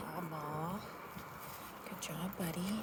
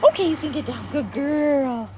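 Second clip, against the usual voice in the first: higher, louder happy release word; excited release; 6 semitones higher, 4 dB louder than usual. Real, not acted. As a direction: cheer her up off the stay. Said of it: "I always say "okay" as my release word".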